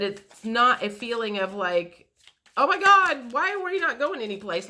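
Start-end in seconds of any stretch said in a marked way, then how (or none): 2.6-4.7 mock panic. Imagined panicked reaction quoted.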